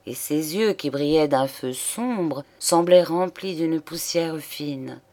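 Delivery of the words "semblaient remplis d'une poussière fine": plain reading voice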